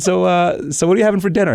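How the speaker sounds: very high, mock-innocent question; sarcastic. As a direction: set him up for the next slip. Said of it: "sarcastic line of questioning coming his way".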